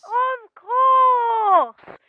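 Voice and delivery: loud, sneering villain; loud gloating Potter; gloating shout. Acted. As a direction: bellow it with triumph.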